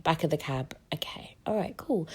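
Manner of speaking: hushed whisper; whispered aside. Retold or imitated. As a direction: breathe it out under your breath. Whispered aside at the birth moment.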